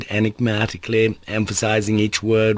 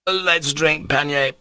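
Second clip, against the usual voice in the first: blurted toast; 7 semitones higher, slower than usual. Acted. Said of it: "he blurted out".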